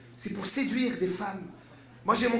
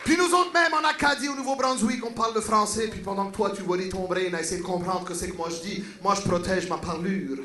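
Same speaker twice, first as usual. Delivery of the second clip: lightly voiced Acadian French; a hint of New Brunswick French; Acadian accent; 3 semitones lower than usual. Acadian defending his way of speaking, light Acadian accent.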